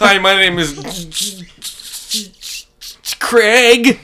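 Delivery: slow, loud, high put-on greeting; mock introduction. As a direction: pause long before the name for effect. Answering with a character name.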